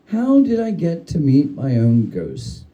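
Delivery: plain reading voice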